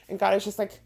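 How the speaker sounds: normal talking voice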